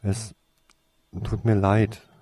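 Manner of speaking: ordinary delivery, nothing marked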